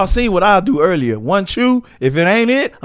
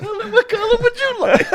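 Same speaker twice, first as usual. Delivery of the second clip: laughing. Real, not acted. Mocking friend picking bean colors.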